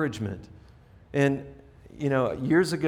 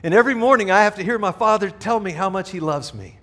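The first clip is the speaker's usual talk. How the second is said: imitating Floyd; 5 semitones higher, 5 dB louder than usual. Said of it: "stand up and say".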